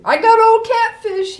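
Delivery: high, loud cry from the treetop; cried out. Acted. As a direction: shout it down to them. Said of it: "he cried".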